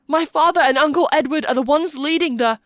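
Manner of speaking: high, loud, desperate blurt; panicked confession. A narrator's reading. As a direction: plead it out until cut off. Toby desperately confesses under threat.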